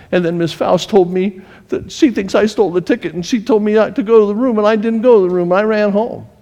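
put-on high, fast, tearful boy blurting out the story; a sobbing kid rushing his words to dad; tearful child. Crying child telling dad.

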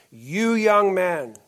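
loud, slow, pointed call across the room; single him out from the pulpit, drawn out; calling out a young man. Retold or imitated. Farmer preacher singling out young Charlie in the back row, slow loud call. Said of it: "called him out in front of everybody".

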